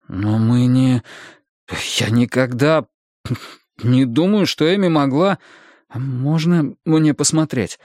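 halting, broken by pauses; stammering. Acted. Husband asks to see the bills, stammering. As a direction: falter between phrases, searching for words. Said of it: "запинаясь, попросил я".

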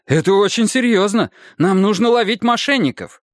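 high, urgent insistence; indignant. Acted. Husband urges detectives to catch swindlers, indignant. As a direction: press them to take it seriously. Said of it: "возмутился я".

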